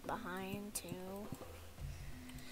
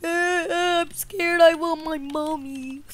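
slow, drawn-out scared whine; play-acted childish fear; mock whimpering boy; 3 semitones higher than usual. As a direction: whine for mother like a little kid.

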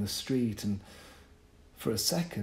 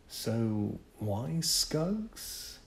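puzzled question; much slower than usual. Acted. Imagined question to the boys.

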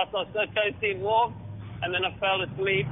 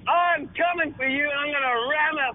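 higher, hammed-up tough-guy threat; answering the French rival with a mock tough-guy threat, higher and hammed-up; mock trash talk; 7 semitones higher, slower than usual. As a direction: growl it like an action hero, playful.

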